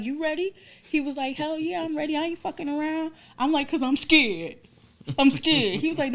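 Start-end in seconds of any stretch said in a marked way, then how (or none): 0.0-0.5 much higher, questioning echo; high-pitched mock nerves; retold nervous question. Retelling nervous question to doctor. 1.4-3.1 high, animated doctor impression; imitating a gung-ho doctor, pitched up; retold cocky reply. Acting doctor's brash reply. 3.7-5.8 high, loud, comic panic; hammed-up fear, pitched way up; retold scared plea. Acting out her fear to doctor.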